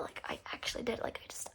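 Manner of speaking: whispered imagined inner voice; hushed, sneaky private confession, imitated; thinking to herself. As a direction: whisper it like a secret thought. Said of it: "She's thinking to herself".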